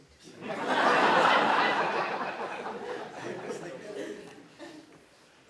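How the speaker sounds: a long, loud burst of laughter; long hearty laugh. Real, not acted. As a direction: let the laugh roll out loud. Laughing at own anecdote. (carcajada larga y fuerte)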